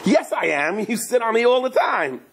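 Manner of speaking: the donkey insisting, a bit high; talking donkey. Acted. Donkey contradicting Bilam, high and indignant. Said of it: "And the donkey goes".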